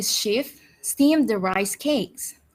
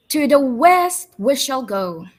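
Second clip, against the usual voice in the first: a louder kingly proclamation; royal declaration; 3 semitones higher, 6 dB louder than usual. Acted. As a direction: proclaim it to the whole court. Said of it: "So the king declared".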